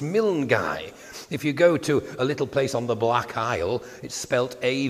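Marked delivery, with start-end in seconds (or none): 0.0-0.9 Milngai said slowly as miln-GUY; local pronunciation. Shown as a demonstration. Putting visitors right, Milngai pronounced miln-GUY. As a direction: end Milngai on a stressed "guy". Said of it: "It's Milngai".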